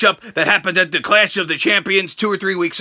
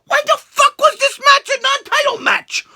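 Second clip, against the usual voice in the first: outraged rant. Real, not acted. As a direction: yell it in disbelief at the bookers. Ranting about non-title match.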